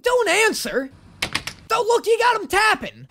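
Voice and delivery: high-pitched, panicked warning; alarmed outcry. Real, not acted. Viewer warning a character not to answer, alarmed outcry. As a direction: cry out as if to stop him. Panicked reaction to villain's question.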